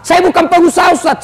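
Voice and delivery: excuse-making congregant. Retold or imitated.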